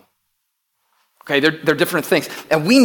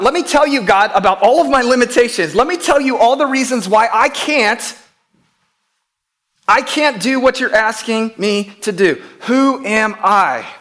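pleading protest; 7 semitones higher, 5 dB louder than usual. Acted. Believer protests God's call.

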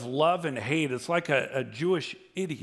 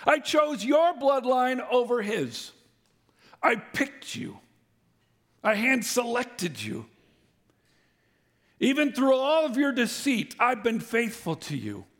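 God declaring love; 9 semitones higher than usual. Acted. God tells Israel he chose them, fervently. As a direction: preach it as God's own claim. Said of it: "God says".

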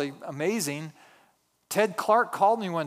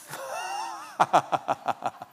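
breathy laugh. Real, not acted. Laughs at own joke.

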